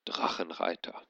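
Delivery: usual speaking voice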